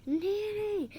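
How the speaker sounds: high, loud cry for Nanny; acted scream. Exasperated tutor screaming for Nanny, acted scream. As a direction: scream it out high and falling at the end. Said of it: "And then he screams".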